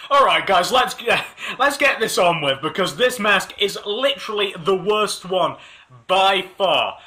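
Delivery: usual speaking voice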